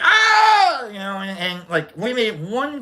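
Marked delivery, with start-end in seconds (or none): 0.0-0.9 high piercing shriek of startled fans; retold shriek. Chiefs fans hit by shaken beer, high shriek. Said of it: "and they're like".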